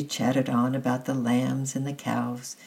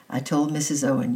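usual speaking voice

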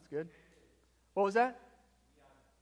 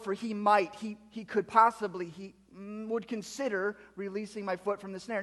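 mock hesitancy; 5 semitones higher, 5 dB louder than usual. Mocks a doubtful reading of verse.

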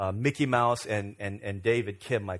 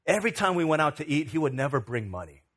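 usual speaking voice

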